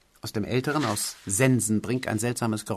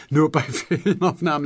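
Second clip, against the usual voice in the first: "Nur bei Filmaufnahmen" higher and louder, broken by a laugh; laughing repeat; 6 semitones higher, 3 dB louder than usual. Acted. Producer echoes the writer's words, laughing out loud. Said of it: "lachte er wieder".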